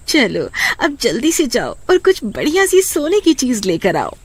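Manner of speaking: high-pitched wife hurrying him off; lightly voiced impatient wife; eager wife. Wife hurries husband off.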